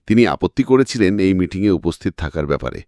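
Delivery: plain reading voice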